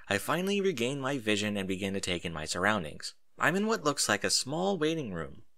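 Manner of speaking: ordinary delivery, nothing marked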